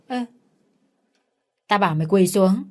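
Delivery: understated narrated fury; furious. A narrator's reading. Enraged husband orders his wife down, understated fury. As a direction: repeat the order with mild anger. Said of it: "Trung điên tiết và chửi".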